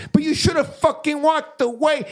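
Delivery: imitating the friend snapping back at the gambler; mocking friend. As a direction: scold him from up high.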